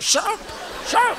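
high, urgent shut up; high-pitched shocked hiss of a father; shocked hushing. Retold or imitated. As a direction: hush him fast, scandalised. Embarrassed father hushing him.

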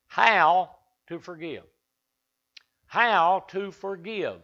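preacher drawing out How to forgive; slow emphasis. Real, not acted. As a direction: lean on How and stretch every word. Repeats the title slowly.

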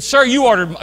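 loud, high clerk cutting in. Retold or imitated. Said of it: "raise your voice".

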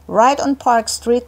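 plain reading voice

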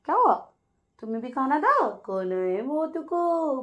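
high, slow coo to the dog. Real, not acted. Mother baby-talking to pet dog. (कुत्ते से तुतलाती आवाज़ में)

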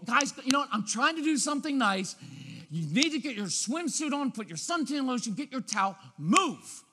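high, tense nagging that builds to a snapped last word; anxious, impatient parent. Acted. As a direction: hurry the kids along, exasperated. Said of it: "continue to use that tone".

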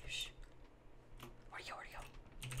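voiceless, hunting whisper. Real, not acted. Gamer searching for a hidden opponent, whispering.

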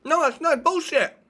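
high, drawn-out outburst; taking it out on the listener; angry protest. Acted. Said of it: "you're taking it out on me".

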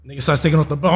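ordinary talk, nothing marked

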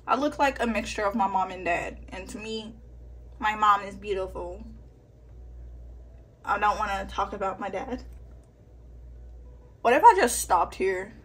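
ordinary delivery

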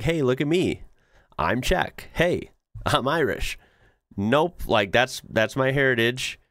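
usual speaking voice

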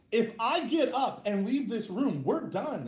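retold wife laying down the law, mildly heated; mock angry ultimatum. Girlfriend threatening to leave him in his bedroom, mock angry. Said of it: "she's crying angry tears".

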